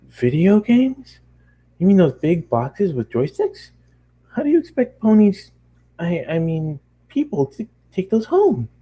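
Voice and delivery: a pony picturing arcade boxes, baffled; puzzled pony. Acted. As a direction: sound amazed and confused. Puzzled pony voiced high.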